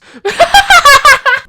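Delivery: loud squealy laugh burst; high squealing laugh. Real, not acted. Laughing at own joke, squealing laugh. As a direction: shriek with laughter.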